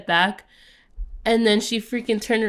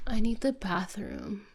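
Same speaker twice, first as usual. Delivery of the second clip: whispered plea; 11 dB quieter than usual. Retold or imitated. Imitating the girl's plea.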